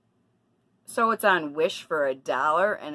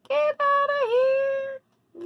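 high, slow squealing get-out; drawn-out falsetto brush-off; mock shriek; much slower than usual. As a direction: shriek it off like a startled shooer. Shooing off unwanted Facebook callers.

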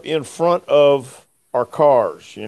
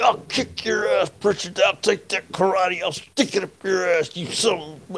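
mock threat; 5 semitones higher, 4 dB quieter than usual. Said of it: "come over to me and say".

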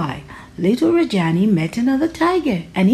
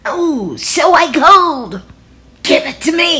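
louder, higher tiger delight; pleased tiger; 7 semitones higher, 6 dB louder than usual. Acted. Tiger delighted with Rajani's idea, loud. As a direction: crow with satisfaction.

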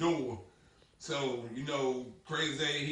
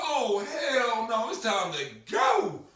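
slow, loud laughing refusal; loud alarm; 6 semitones higher, 5 dB louder, slower than usual. Retold or imitated. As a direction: drag it out loud, ready to bolt. Fleeing the roaches.

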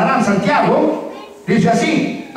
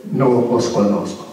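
stern Jesus; 11 semitones lower than usual. Acted. Preacher voices Jesus refusing entry.

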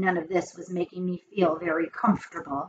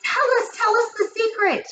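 very high, eager plea; high-pitched excited urging; witches calling out; 14 semitones higher than usual. A narrator's reading. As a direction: clamour for the secret. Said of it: "they called out".

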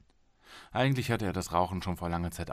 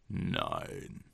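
deep flat no; 11 semitones lower, 5 dB quieter than usual. Acted. Golem's man answers low.

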